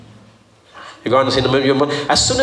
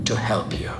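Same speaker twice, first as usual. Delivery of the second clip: soft whispered close; 6 semitones lower, 9 dB quieter than usual. Real, not acted. Preacher ending his point to the church in a whisper. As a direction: drop it to a whisper.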